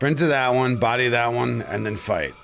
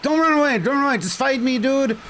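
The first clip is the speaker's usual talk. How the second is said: frustrated yelling; 10 semitones higher, much faster than usual. Real, not acted. Gamer yelling at a fleeing boss, real frustration. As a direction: shout after a fleeing foe.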